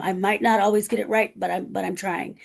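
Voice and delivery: ordinary talk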